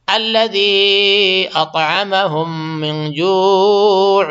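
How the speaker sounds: slow, drawn-out chanted tilawat; tajweed recitation; Quran recitation. Acted. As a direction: recite it melodically, stretching the long vowels. Recites Quran between explanations.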